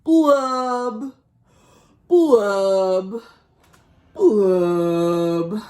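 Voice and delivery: sad pouty fish blubbing; drawn-out mopey fish blubs. Acted. Storyteller voicing the glum fish, mopey blubs.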